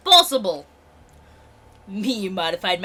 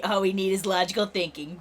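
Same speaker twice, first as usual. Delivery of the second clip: ordinary delivery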